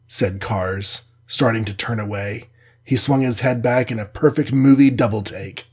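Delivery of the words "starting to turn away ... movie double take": usual speaking voice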